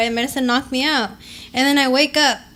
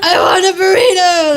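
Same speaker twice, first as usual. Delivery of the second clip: loud high laughing wail; mock cry for help; 7 semitones higher, 9 dB louder than usual. Friend voicing paralysed husband, hammed-up laughing wail. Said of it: "I'm like".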